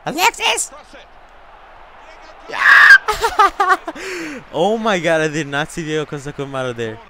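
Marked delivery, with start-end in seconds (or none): 0.1-0.7 high-pitched shriek of a name; alarmed yell. Real, not acted. Gamer yelling at Alexis to stop it, high yell. Urgently yelling at own player. 2.5-4.5 loud "yeah!" breaking into high laughter; exultant scream and laugh. Real, not acted. Gamer celebrating a surprise play, screamed cheer into laughter.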